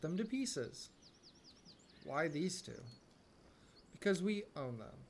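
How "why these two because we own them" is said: normal talking voice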